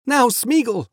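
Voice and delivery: high, gentle appeal to a captive; earnest Frodo. Acted. Frodo addresses bound Gollum, earnest high voice.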